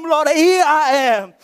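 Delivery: acted cry of surrender in prayer; crying out to God. Preacher shows congregation how to call on God, crying out. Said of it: "cry to God".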